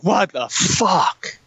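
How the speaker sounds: slow, drawn-out incredulous reaction; retold disbelief. Soldiers hearing he won't deploy with them, drawn-out disbelief. Unit's reaction to leader leaving.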